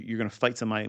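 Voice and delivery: ordinary talk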